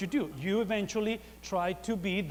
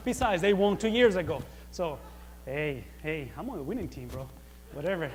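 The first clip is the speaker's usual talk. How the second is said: normal talking voice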